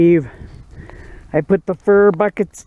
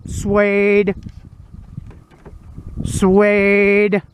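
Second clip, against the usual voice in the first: higher coaxing call to the dog; 3 semitones higher, much slower than usual. Real, not acted. Owner calling Suede to stay close, coaxing. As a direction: coax the dog to stay near.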